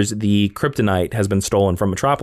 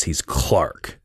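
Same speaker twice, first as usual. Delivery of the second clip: lower, a hint of a hero voice; Clark voice; 9 semitones lower, 4 dB quieter than usual. Shown as a demonstration. Host showing how his Clark sounds. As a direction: announce Clark with a touch of gravitas. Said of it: "He's kinda like this".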